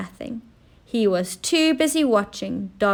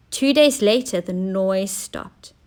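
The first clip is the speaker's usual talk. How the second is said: plain reading voice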